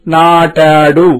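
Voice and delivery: నాటాడు drawn out very slowly, rising at the end; slow model word. Shown as a demonstration. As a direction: read నాటాడు slowly for the class. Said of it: "ఈ క్రిందికి దిగిన మాట".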